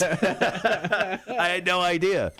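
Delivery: slow, high, laughing through the words. Real, not acted. Host recalls crying after a concussion, laughing slowly. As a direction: let the laugh stretch the line out.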